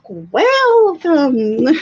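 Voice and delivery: slow, drawn-out spooky welcome; creepy fortune teller. Acted. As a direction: stretch it like a creepy psychic. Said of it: "I really hope I sound like a super creepy fortune teller lady".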